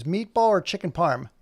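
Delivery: normal talking voice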